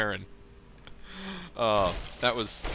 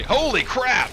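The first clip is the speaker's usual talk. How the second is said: sudden loud high exclamation; surprised shout; 9 dB louder, slower than usual. Real, not acted. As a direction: blurt it out in shock. Sudden find while playing.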